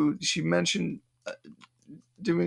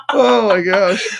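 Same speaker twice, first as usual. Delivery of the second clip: laughing; 7 semitones higher, 9 dB louder, slower than usual. Real, not acted. Laughing after reading review.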